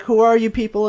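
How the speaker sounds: lightly acted, high-pitched panicked girl; high voice, a touch of alarm; frightened girl. Retelling the girl tied in the basement, mildly frightened.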